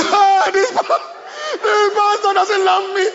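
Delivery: high, slow whimpering complaint; hammed-up crying complaint; mock whining. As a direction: whine like a hurt child.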